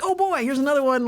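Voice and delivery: high, loud and hammed-up, eyes-rolling sigh of a line; mock exasperated. To himself, as another critic email arrives: hammed-up weary exclamation. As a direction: play it like a theatrical groan at yet another email.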